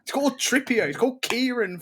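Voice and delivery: "Trippier" said the English way, hard and high; furious fan correcting commentators; exasperated insistence. Real, not acted. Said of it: "You're not putting a little accent over the E".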